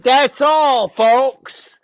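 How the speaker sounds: high, loud cartoon pig sign-off; Porky Pig. Guest quoting the cartoon ending to the host, high and loud.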